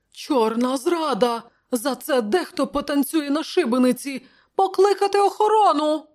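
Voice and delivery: high-pitched alarmed counsellor; panicked outcry. A narrator's reading. Fat counsellor crying treason over the corpses, panicked. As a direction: build to a high, loud call for guards.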